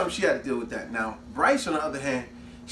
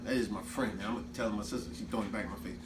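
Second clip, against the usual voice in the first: 1 inner thought; 6 semitones lower, 9 dB quieter, faster than usual. Retold or imitated. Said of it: "she realized".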